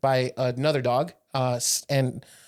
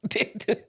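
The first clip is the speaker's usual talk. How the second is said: high laugh; 8 semitones higher than usual. Real, not acted. Cracking up.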